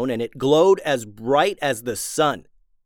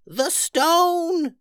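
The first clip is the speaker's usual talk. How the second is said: put-on old witch yelling. Old witch calling up to Ulysses from the ground, high yell. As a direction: shout it up from far below as the old witch. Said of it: "yelled Old Witch Tubes".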